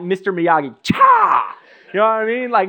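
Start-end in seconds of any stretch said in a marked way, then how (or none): 0.0-1.6 karate cry. Retold or imitated. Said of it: "I'm like".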